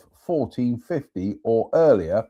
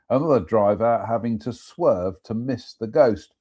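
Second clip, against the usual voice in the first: ordinary talk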